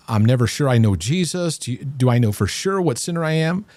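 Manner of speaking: ordinary talk, nothing marked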